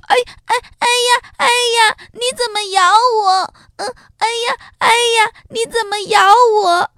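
high, loud, fast panicked yelping; crying out in pain. A narrator's reading. Giraffe with its head bitten by the wolf, screaming in pain. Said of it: "长颈鹿痛得叫起来说".

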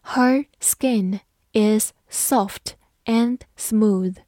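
slow, deliberate sentence model; slow teacher read-aloud. Shown as a demonstration. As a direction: read it slowly word by word. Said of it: "好，我们慢慢来读".

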